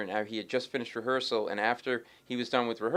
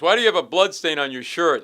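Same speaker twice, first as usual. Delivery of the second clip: loud, higher mock-alarmed question; retold confrontation; 6 semitones higher, 12 dB louder than usual. Senior partner confronts bloodstained lawyer, loud mock alarm. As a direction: confront him as an appalled boss would.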